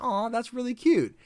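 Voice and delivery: high-pitched "aww" at something cute; mock touched cooing. Player replying to a sweet stargazing gesture, cooing high.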